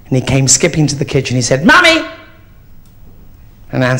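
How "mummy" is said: put-on high, loud child's call; a small boy bursting in shouting for mummy; excited little boy yelling mummy. Child bursts in calling mother.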